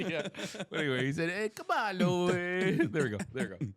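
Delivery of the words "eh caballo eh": high-pitched, hammed-up Italian accent; mock Italian accent. Food stand seller offering caballo to a customer, mock Italian accent. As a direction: put on an exaggerated Italian lilt, pitched up.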